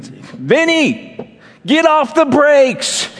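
high, drawn-out imitated shout; retold partner's shout. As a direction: yell it slowly like an alarmed partner, pitch way up. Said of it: "So loudly and clearly, he shouted".

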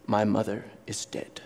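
whispered low and dead flat; stoic deadpan whisper. Retold or imitated. Professor quoting a stoic on a mother's death, whispered flat. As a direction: say it with no feeling at all, in a whisper. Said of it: "If a Stoic's mother dies, they say".

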